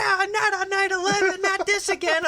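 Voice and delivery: very high, squealing falsetto panic; mock panicked screaming. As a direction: play a hysterical plane full of passengers. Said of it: "everyone starts screaming and shouting".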